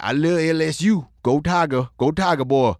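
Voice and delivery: imitating a thick Louisiana fan; thick Louisiana accent. New acquaintance cheering for LSU, imitated thick Louisiana accent. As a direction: put on a heavy Louisiana accent, words run together. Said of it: "real thick Louisiana accent".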